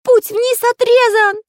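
high, loud, drawn-out cry of despair; desperate shout. A narrator's reading. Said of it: "закричал в отчаянии Карандаш". (отчаянный крик высоким голосом)

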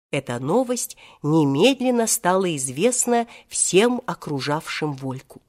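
ordinary delivery

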